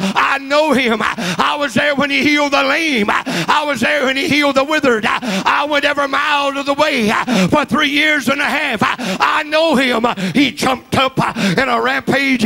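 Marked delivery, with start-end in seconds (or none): 0.0-10.1 a panicked demon vouching to his king; frantic imp. Acted. Imp reports Jesus to king.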